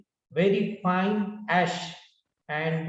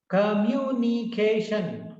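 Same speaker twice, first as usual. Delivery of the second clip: "Communication" in five slow beats; syllable by syllable; 3 semitones higher, slower than usual. Shown as a demonstration. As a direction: stretch each syllable of "Communication". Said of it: "Five syllables".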